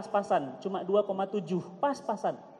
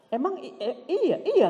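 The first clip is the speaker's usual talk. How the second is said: flustered stammer; 7 semitones higher than usual. Retold or imitated. Nervous father stammers.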